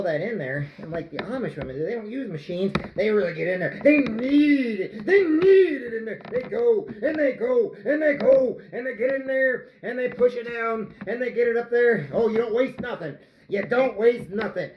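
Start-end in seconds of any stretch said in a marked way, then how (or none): 3.8-14.7 high, loud rhythmic chant of effort; hammed-up vigour. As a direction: drive each phrase like a hard shove.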